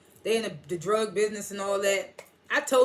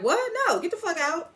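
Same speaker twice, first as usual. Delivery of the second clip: high, sharp outburst of disbelief; outraged yell; 9 semitones higher than usual. Retold or imitated. Viewer scoffing at Salim's plea, outraged yell. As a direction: throw him out, voice jumping high.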